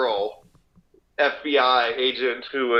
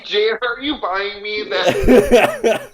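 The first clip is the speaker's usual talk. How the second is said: laughing slow plea; 5 semitones higher, 7 dB louder, much slower than usual. Pretend. Mock pleading to FBI agent.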